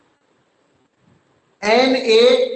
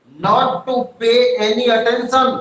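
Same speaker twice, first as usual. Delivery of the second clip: word by word, very slow, for students to write; slow dictation; much slower than usual. Shown as a demonstration. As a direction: spell it out slowly so they can copy. Teacher dictating meaning slowly. (धीरे-धीरे लिखवाते हुए)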